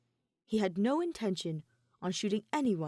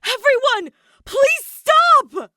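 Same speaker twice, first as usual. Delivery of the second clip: all-out cry to halt them; desperate shout; 19 dB louder, much slower than usual. A narrator's reading. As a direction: shout it at the top of your lungs, high and loud. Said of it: "as loud as he could".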